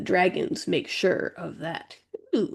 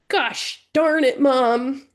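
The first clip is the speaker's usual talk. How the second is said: higher, slow, exasperated outburst; snapping sister; 6 semitones higher, 5 dB louder, much slower than usual. A narrator's reading. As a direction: snap it at mom in frustration. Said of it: "Mira snaps".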